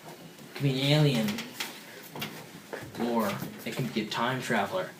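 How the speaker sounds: low, very soft storytelling; hushed suspense. Acted. Storyteller creeping closer to the time machine, hushed suspense. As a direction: drop right down as if creeping up on something unknown.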